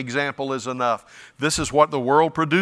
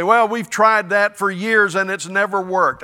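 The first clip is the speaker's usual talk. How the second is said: higher, louder skeptical objection; mock doubting churchgoer; 6 semitones higher, 6 dB louder than usual. Preacher mimics a doubter in the pews, higher and louder. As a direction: voice a tired skeptic. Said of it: "You say".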